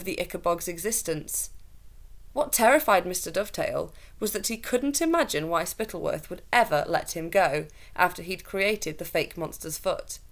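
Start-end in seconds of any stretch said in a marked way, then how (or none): none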